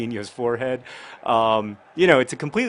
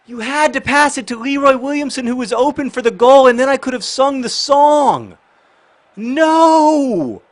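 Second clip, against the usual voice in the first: yelling high and loud at the screen; furious, high-pitched outburst at a blown pass; angry shout; 14 semitones higher, 10 dB louder, slower than usual. Real, not acted. Goal chance missed in the game.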